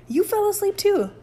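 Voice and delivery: high, loud, outraged retort; indignant accusation. Acted. Mike blaming Rudy for falling asleep while steering, indignant.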